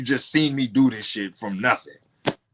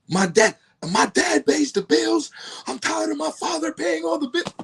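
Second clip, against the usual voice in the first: mocking wife; 4 dB louder than usual. Imitating his wife complaining about her father paying, high whine. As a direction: parrot her line in a much higher whiny voice. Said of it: "then she busts out with the".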